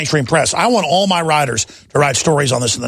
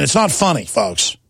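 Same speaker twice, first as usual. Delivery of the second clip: ordinary talk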